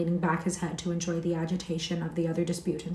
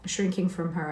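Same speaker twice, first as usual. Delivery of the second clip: plain reading voice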